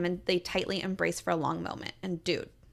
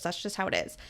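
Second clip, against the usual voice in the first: ordinary talk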